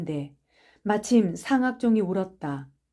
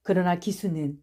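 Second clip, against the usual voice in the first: ordinary delivery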